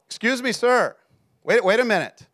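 high, loud imitation of a waitress calling after someone; screamed call-out. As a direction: call out urgently to stop a departing customer. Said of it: "screams out".

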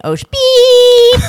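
a big slow bleep replacing the swear; mock censor beep. Shown as a demonstration. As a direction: cut off the curse and sing a loud high beep. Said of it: "watch this".

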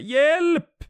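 very high, loud cry for help; scream for help. A narrator's reading. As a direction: scream desperately for rescue. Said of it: "skriker jag". (desperat skrik på hjälp)

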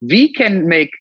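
mock advert in a hammed-up German accent; exaggerated German accent. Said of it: "that's also when then the German accent started kicking in".

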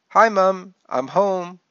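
announcing call. A narrator's reading. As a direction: call out that you're home. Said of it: "I announced".

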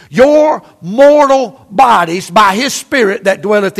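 drawn out, weighty reading; slow each word down to land it; solemn slowed scripture; slow emphatic scripture. Acted. Preacher slows scripture for weight.

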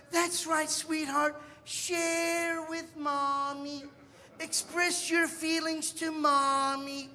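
mock-gentle mother humoring a brat; syrupy soothing mom. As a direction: saccharine mommy voice, drawn out. Indulgent mother coddling screaming kid.

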